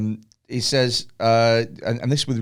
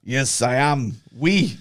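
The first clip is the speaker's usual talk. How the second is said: French-tinged answer, lifting on the last word; lightly imitated French footballer; mock French accent; much slower than usual.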